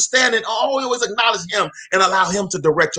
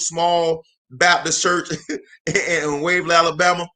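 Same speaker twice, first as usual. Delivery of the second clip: ordinary talk, nothing marked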